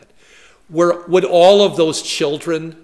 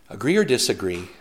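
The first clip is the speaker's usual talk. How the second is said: quicker, softer, lower textbook question; taught phrasing; 5 semitones lower, 5 dB quieter, faster than usual. Shown as a demonstration. Preacher to congregation, showing the schoolbook way, quick and soft. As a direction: rattle off "Agree or disagree?" like a classroom drill. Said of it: "I was taught in school how to phrase these".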